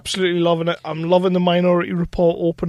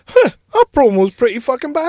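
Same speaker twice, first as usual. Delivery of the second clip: higher, louder, re-enacted pleasant surprise; impressed reaction; 7 semitones higher, 6 dB louder, faster than usual. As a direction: play up being impressed by your own promo. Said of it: "I was sat there going".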